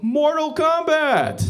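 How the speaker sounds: high, loud, slow Mortal Kombat cry; hammed-up game announcer. Thai crowd at a street fight, booming. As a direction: bellow it like the arcade voice. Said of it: "They're like".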